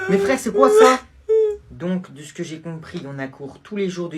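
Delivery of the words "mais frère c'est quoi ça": high, loud indignant cry; outraged shout. Acted. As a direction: scream in disbelief. Outraged at timetable.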